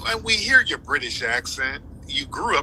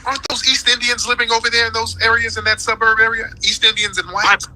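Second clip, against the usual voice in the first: louder and higher, rushed and scoffing; loud mocking question; 7 semitones higher, 6 dB louder than usual. Real, not acted. Debater taunts his opponent with a loud, fast question. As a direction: fire it back loud and fast.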